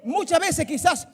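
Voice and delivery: loud, rising pulpit delivery; full-force preaching. Acted. Pastor urging the congregation to let go, full-force preaching.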